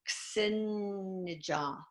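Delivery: Ksenija said very slowly and tentatively, sounding it out; unsure pronunciation. Shown as a demonstration. Host trying a listener's name: Ksenija sounded out slowly. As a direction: Ksenija drawn out syllable by syllable, unsure. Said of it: "if I knew how to pronounce it".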